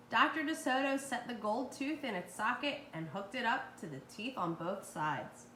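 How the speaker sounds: ordinary delivery, nothing marked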